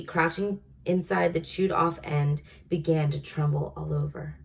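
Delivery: ordinary delivery, nothing marked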